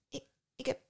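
hesitant stutter; stammering. A narrator's reading. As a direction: falter and restart, unsure of your words. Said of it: "stotterde Psyche".

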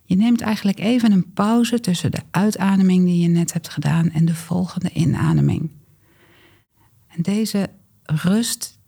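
calm coaching voice; guided breathing. Acted. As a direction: ease them into the pause. Guides the exhale and pause.

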